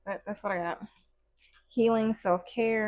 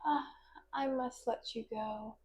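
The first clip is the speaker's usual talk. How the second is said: soft, hammed-up sorry-not-sorry; a mock show of regret, said softer; mock fake apology; 9 dB quieter than usual. Imitating someone dumping an ex with a fake sorry. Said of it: "pretend like, fake apology".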